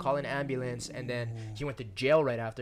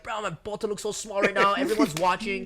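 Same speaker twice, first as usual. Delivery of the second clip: high, loud embarrassed panic; panicked inner voice; 8 semitones higher, 8 dB louder than usual. Retold or imitated. Guard being lifted in a hug, loud high panic. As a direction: squeal it out while hoisted like a kid.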